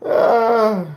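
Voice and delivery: high, loud yelp; yell. Real, not acted. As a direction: let out a sudden loud yell. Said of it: "if you ever hear me just yell like that".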